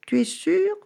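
high, wary cat question; suspicious. Acted. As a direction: ask it doubting every word. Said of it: "demanda le chat méfiant".

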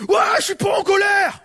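imitating an angry woman yelling she is not angry; angry denial. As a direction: shout it back, furious that anyone asked. Angry person denies anger, shouting.

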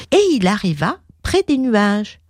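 ordinary delivery

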